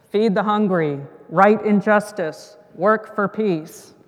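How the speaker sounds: loud, slow, weighty commands; God's voice. Acted. God answering the frog from above, loud commanding voice. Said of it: "And God answers".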